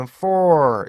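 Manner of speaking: for stretched into one long vowel; wrong, merged for; for as one sound. Shown as a demonstration. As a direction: drag for out in a single glide. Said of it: "이렇게 하나로 발음하시는 경우가 많은데".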